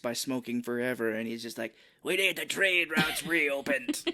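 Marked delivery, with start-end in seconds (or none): 2.0-4.0 slow, gravel-voiced villain line; smoky Kiefer Sutherland impression. Mocking the film villain's smoky voice, slow. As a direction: drag it out like a lifelong smoker. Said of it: "this is how he sounds".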